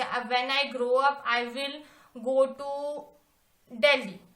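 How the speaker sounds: slow dictation. Shown as a demonstration. Teacher dictating English sentence.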